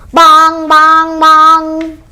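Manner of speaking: loud ringing bong, bong; loud bell bongs. A narrator's reading. Storyteller booming bell sound to the children. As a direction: call out the bell like a big church bell.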